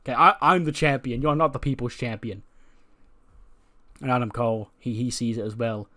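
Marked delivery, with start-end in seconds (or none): 0.0-2.4 a hammed-up heel claiming his belt; Kevin Owens yelling. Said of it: "He goes".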